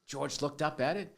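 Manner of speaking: normal talking voice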